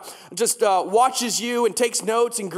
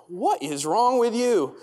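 slow, incredulous retort; shocked disbelief; slower than usual. Retold or imitated. Instructor stunned by the student's request for change, shocked. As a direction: stretch it out in mock shock. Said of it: "with the shock on her face".

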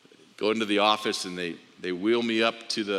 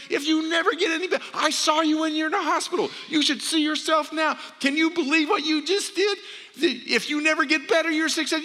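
much higher and louder, overjoyed shouting; excited screaming; 4 dB louder than usual. Retold or imitated. Doctor screaming with joy at his walking patient, overjoyed shout. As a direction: shout it like you can't believe your eyes. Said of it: "he starts screaming".

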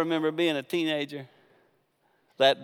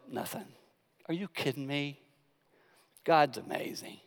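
ordinary delivery

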